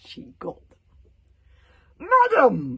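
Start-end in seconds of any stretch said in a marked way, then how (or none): 2.0-2.8 loud high bellow of injured pride. A narrator's reading. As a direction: bellow it across the hall. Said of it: "bellowed Stoic the Vast".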